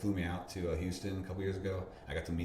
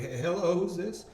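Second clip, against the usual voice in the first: higher, louder, suddenly alert hello; surprised phone greeting; 11 semitones higher, 5 dB louder, slower than usual. Retold or imitated. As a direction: realize the caller knows you. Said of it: "I'm like".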